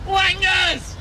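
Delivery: a very high, loud jeering yell; scoffing cry thrown at the boy; mocking shout. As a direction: fling the word out high and loud. Social worker mocks the boy.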